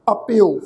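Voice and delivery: ਅਪਿਓ with i gliding into o, falling; pronunciation demo. Shown as a demonstration. Teacher answering how the second word is pronounced, careful demo of ਅਪਿਓ. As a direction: model ਅਪਿਓ on its own, -io run together. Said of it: "ਇਹਨਾਂ ਦੋ ਸ਼ਬਦਾਂ ਦਾ ਉਚਾਰਨ".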